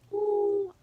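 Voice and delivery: high, loud ooh of annoyance. Real, not acted. Reacting to her friend's betrayal with a loud ooh. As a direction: let out a sharp, loud ooh. About to tell how mad she got.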